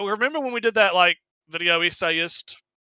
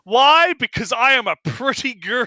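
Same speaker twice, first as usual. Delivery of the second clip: mock cutesy; 6 semitones higher, 5 dB louder than usual. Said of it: "three ways of talking".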